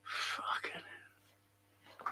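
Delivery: slow, whispered curse; muttered exasperation. Real, not acted. Streamer cursing to himself about complaints, whispered exasperation. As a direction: swear slowly under your breath. Swears under his breath, exasperated.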